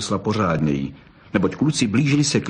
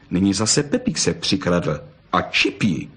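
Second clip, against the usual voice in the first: usual speaking voice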